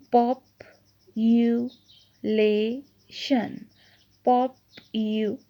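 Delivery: "Population" in separate syllables with long pauses. Shown as a demonstration. Teacher dividing a word for pupils, "population" said one syllable at a time. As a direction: break "population" into pop, u, la, tion, each standing alone. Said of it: "सबसे पहले syllable division को देखें".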